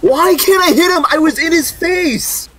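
high, loud exasperated complaint; frustrated outburst. Real, not acted. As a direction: whine in disbelief at the miss. Frustrated after missing an enemy.

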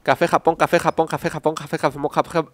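tongue-twister attempt, rushed; fast tongue twister. Shown as a demonstration. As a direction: rattle off café Japón quickly. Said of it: "lo voy a intentar".

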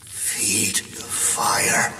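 very slow, soft, otherworldly voice; a ghostly voice luring from the dark; eerie whisper. A narrator's reading. Eerie disembodied voice in forest.

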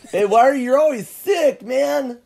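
high, laughing stoner voice rising into a loud yell; stoner dude. Retold or imitated.

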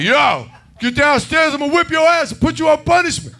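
high, loud fake scolding; stern dad yelling his son down the stairs; pretend-angry father orders son downstairs; mock angry father.

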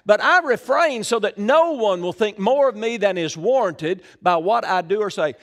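forceful preacher reading from the Bible; scripture reading. Acted. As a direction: proclaim the verse to the whole room. Reads scripture aloud to congregation.

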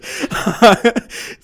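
rising burst of laughter; high laugh. Real, not acted. Both laughing at joke.

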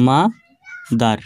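মা-দার slowly, মা rising and held, then a gap before দার; split into two syllables. Shown as a demonstration. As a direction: say মা-দার as two separate beats. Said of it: "দুইবারে উচ্চারণ হলো".

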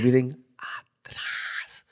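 slow unvoiced whisper; slow hushed whisper. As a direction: breathe the words out very slowly. Whispered reply about aliens.

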